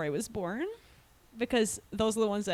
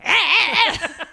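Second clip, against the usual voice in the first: Pee Wee impression; 6 semitones higher, 8 dB louder than usual. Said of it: "Can't do it".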